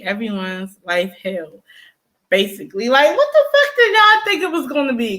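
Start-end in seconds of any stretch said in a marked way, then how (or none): 2.9-5.2 high-pitched, fast, incredulous; exasperated outburst. Real, not acted. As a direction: fling the question at the audience.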